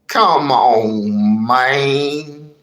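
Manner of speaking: ordinary talk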